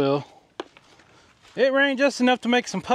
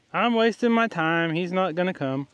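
ordinary talk, nothing marked

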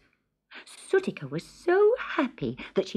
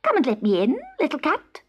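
higher, coaxing goblin voice; wheedling hobgoblin; 9 semitones higher, faster than usual. A narrator's reading. Hobgoblin at the window asking the cat in, high wheedling voice. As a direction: coax it, rising high at the end.

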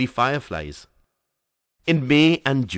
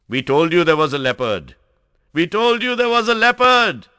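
high, loud shout building higher; jubilant shout; 9 semitones higher, 7 dB louder than usual. A narrator's reading. Hunters boasting of their kill to the narrator, shouting in triumph. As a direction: shout it out, climbing to a high pitch on the repeat. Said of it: "they shouted".

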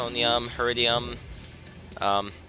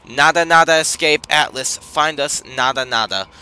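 loud, higher, ominous message; dramatic reading; 3 semitones higher, 7 dB louder than usual. Acted. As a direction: read it like an eerie transmission. Reading cryptic alien message.

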